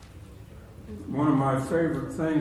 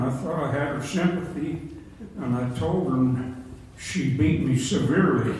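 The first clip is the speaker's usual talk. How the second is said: ordinary talk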